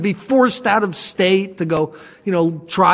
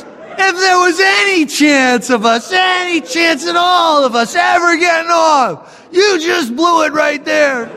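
exasperated lawyer; 9 semitones higher than usual. Retold or imitated. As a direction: yell it in disbelief, laughing. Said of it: "He goes".

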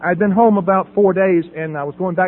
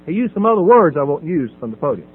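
normal talking voice

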